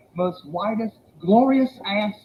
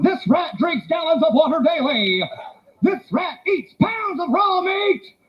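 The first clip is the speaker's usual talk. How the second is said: barker spiel; 4 semitones higher than usual. Retold or imitated. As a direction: sell the rat like a barker. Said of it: "And he kept going".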